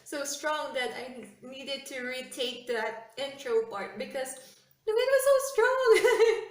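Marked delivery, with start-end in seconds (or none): 4.9-6.4 much higher and louder, bursting out; excited exclaim. Real, not acted. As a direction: exclaim it, caught up in the memory. Recalls windy intro, laughing.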